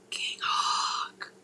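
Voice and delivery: sharp gasp, then a whispered name. Real, not acted. Surprised by a chat name.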